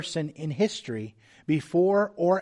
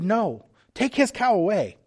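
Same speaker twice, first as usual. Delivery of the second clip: higher and louder, bitter refusal; spiteful farmer; 6 semitones higher, 4 dB louder than usual. Acted. Envious farmer answering the Lord, spitefully higher and louder. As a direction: snap it out of pure envy.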